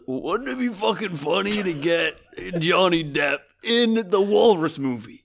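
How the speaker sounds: mock stoner pitching a gag; stoned Kevin. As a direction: play the director as giggly high. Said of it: "Kevin is high and goes".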